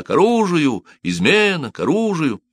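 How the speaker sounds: high-pitched cry to arms; shouting alarm. A narrator's reading. Cobbler raising the alarm at the door, shouting. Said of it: "крича".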